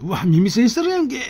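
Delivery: caught-out indignant surprise; shocked outburst. Retold or imitated. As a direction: blurt it out in disbelief. Shocked at senior's earplugs.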